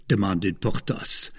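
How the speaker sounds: ordinary delivery, nothing marked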